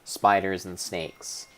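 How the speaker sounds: usual speaking voice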